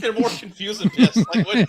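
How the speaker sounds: loud, high words through laughter; real helpless laughter; laughing hard. Real, not acted. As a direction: barely get it out while cracking up. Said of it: "I'm laughing so hard".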